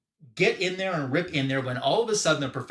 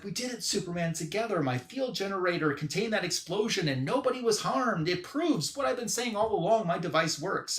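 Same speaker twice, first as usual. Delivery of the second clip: raised pitch, mock-triumphant inventor; excited triumph; 6 semitones higher than usual. Professor tells Superman his device worked, triumphant. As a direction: crow about it in a lifted voice.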